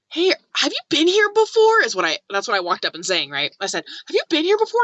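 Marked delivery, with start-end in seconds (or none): 0.1-1.8 high, loud, cheery hello to a stranger; bright friendly greeting. Retold or imitated. Greeting a stranger at the campsite, high and cheery. 4.1-4.8 high, loud, cheery hello to a stranger; bright friendly greeting. Retold or imitated. Greeting a stranger at the campsite, high and cheery.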